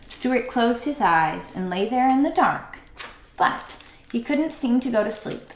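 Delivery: ordinary delivery, nothing marked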